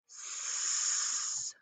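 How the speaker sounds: unvoiced snake hiss "S". Shown as a demonstration. Teacher hissing S like the snake. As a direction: let "S" hiss out. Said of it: "S says".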